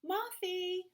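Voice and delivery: high calling voice for Mama. A narrator's reading. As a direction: call the child from another room. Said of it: "Mama called".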